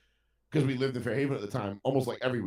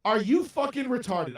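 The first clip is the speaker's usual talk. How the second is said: much higher, loud insult; retold angry outburst; 10 semitones higher, 3 dB louder than usual. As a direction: blow up at the kid, pitched way up. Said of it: "went absolutely fucking ballistic on this kid".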